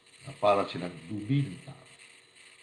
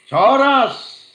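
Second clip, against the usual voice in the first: preaching shout; 17 dB louder, much slower than usual. Acted. Preacher warning the rich.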